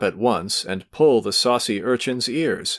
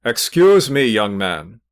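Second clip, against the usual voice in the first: exclaimed irritably; 6 dB louder, slower than usual. A narrator's reading. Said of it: "exclaimed irritably".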